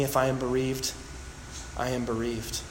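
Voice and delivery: soft, sinking, bitter resignation; quiet bitter surrender. Retold or imitated. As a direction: let the words fall away in defeated grief. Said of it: "Do you hear it laced with bitterness?".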